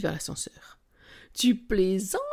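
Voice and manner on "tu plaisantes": incredulous cry into the phone; mock exclamation. A narrator's reading. Capucine cries out to her friend on the phone, incredulous. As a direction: exclaim in disbelief. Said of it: "s'écrie Capucine dans le combiné".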